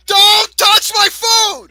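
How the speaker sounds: loud, slow angry shout. Real, not acted. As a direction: yell to protect your food. Family member grabs his food.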